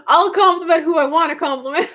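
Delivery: high, sassy comeback; playful defiance. Real, not acted. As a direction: toss it back cheekily.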